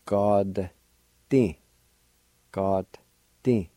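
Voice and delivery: God, then ti, said slowly as separate syllables; Cherokee syllables. Shown as a demonstration. Teacher sounding out the Cherokee word button for learners. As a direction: sound out God and ti one at a time. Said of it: "It's pronounced like this".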